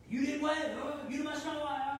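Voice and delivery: huffy, pressing for an answer; mock offended lady. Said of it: "retold quarrel".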